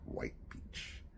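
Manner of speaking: hushed, slow name-drop; softly, under your breath. Retold or imitated. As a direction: say it low, as if not to be overheard. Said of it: "a name that was spoken under breath".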